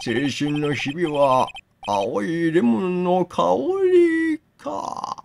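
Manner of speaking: slow lightly voiced old master; old man voice. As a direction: drawl like an elderly lawyer. Voices the old mentor Hoshikage.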